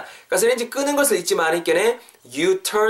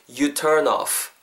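ordinary talk

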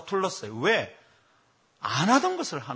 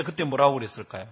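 ordinary delivery

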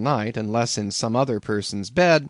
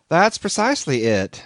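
higher, louder smug innkeeper; calm landlord; 6 semitones higher, 5 dB louder than usual. A narrator's reading. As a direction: answer like it's obvious. Landlord replies.

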